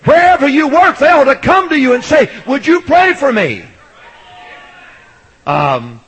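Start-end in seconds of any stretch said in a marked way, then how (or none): 0.0-3.6 high, loud pulpit holler; full-force preaching. Real, not acted. Preacher urging churchgoers to be sought out, hollered preaching. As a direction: drive it out to the whole church.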